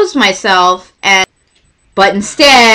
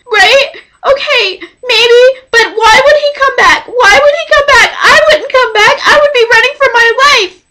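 very high, hammed-up panic; freaking out; 13 semitones higher than usual. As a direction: wail the doubts in a high frantic voice. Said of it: "freaking out on the phone".

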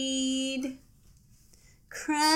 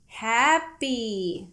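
plain reading voice

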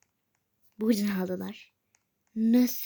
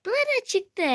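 startled outburst; 8 dB louder than usual. Real, not acted. Something suddenly appears in game.

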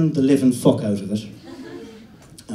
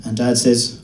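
ordinary talk

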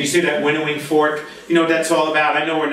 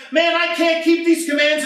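exasperated cry; 12 semitones higher than usual. Retold or imitated. Voicing frustrated believer.

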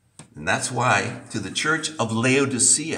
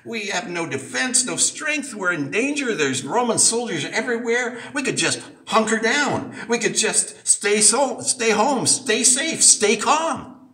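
much higher, anxious fretting; frightened women; 11 semitones higher than usual. Retold or imitated. Preacher voicing the frightened women at the tomb, high and anxious. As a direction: fret in a high worried voice. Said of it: "looked around and said".

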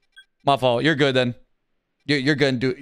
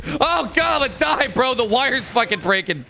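much higher panicked cry; mock panic; 10 semitones higher than usual. Real, not acted. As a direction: yelp like the game is lost. Controller wire breaking mid-game.

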